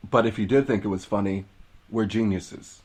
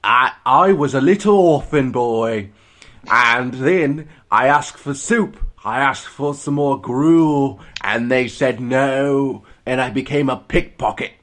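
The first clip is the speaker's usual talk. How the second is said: put-on high, loud, slow little British boy; higher, louder child voice in a British accent; British orphan boy; 4 semitones higher, 8 dB louder, slower than usual. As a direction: beg like little orphan Oliver. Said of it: "standard British dialect".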